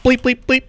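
very high mock censor bleeps; playful bleep sounds.

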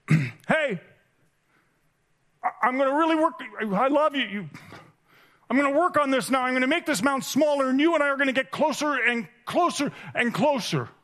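put-on a kid promising to do better in a high, anxious voice; desperate promise. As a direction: beg him across the mound not to give up. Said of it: "And I want to yell out".

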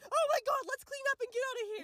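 high, hurried imitation of an alarmed friend; mock panic. Imitating Lexi wanting out of there, high mock panic. Acting out Lexi's panicked reply.

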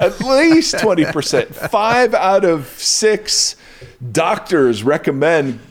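high, slow, beaming commercial pitch; chipper 1950s ad. Pretend. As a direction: sell it like an old TV announcer. Mock ad claim about cleansing power.

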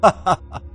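high, loud mad cackle; crazed laugh. A narrator's reading. Jealous friend breaks down hearing the admission, crazed laughter. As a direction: burst into maniacal laughter. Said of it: "陆明轩发疯地笑了起来".

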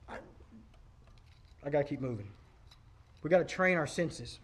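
quiet, intense preaching; hushed. Real, not acted. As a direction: drop the voice low and urgent.

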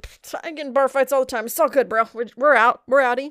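cocky dude voice. Retold or imitated. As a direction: shrug it off like a bro. Voicing Mal bragging.

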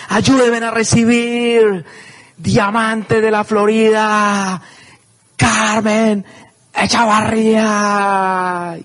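high, slow, stretched-out introduction; stage announcer. Retold or imitated. Announcer presenting the new Diamond to thousands, slow and high. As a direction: call her name like a boxing ring announcer. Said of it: "pegan un grito desgarrador".

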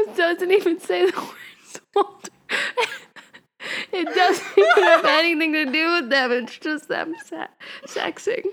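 high, laughing protest; giggling disbelief. Real, not acted. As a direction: giggle through the complaint. Reader giggling at explicit fanfic.

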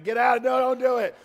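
hammed-up skeptic waving him off; doubting Thomas. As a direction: Thomas begging Peter to stay in the boat. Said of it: "He totally doubts that it'll work".

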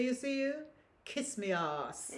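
Grenadian grandmother's retort, high then slowly dropping; drawn-out Grenadian put-down, retold; Grenadian accent. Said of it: "I'm doing with my, um, Grenadian accent".

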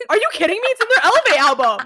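loud, fast, high-pitched disbelief; real incredulous outburst. Real, not acted. Fan to friend who missed an album track, incredulous. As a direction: burst out at your friend's ignorance. Shocked friend doesn't know the song.

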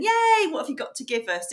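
high-pitched imitated cheer; mock eager crowd. Imitating Florida conference crowd greeting the medium, light eager cheer. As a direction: play an open, welcoming audience. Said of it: "they're all open and".

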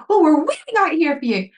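high, loud imitation of a scolding; Vanessa Feltz, angry. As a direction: snap impatiently as an irate presenter, retold. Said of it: "Like, really angry".

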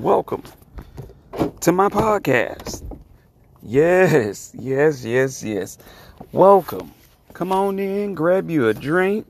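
high, sing-song showman greeting; booming sing song welcome. Acted. Podcast host's showman opening welcome.

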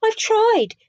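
insisting it's hopeless; woeful. A narrator's reading. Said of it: "Hubert said woefully".

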